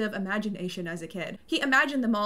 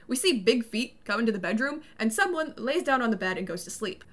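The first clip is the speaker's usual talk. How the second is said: ordinary talk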